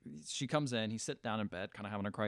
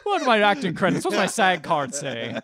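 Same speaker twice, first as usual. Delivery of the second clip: loud, higher voice through laughter; laughing mock confusion; 5 semitones higher, 7 dB louder than usual. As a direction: play the baffled actor while cracking up. Joking over the forgotten name.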